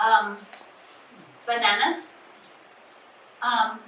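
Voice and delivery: loud hesitating "um" before "bananas"; teacher acting a child struggling to name fruits, loud halting; hesitant child. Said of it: "they'll say, um".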